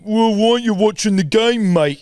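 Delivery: high, loud, snide mocking voice; mocking sports fan. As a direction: needle him like a smug stranger in the stands. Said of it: "So he's going".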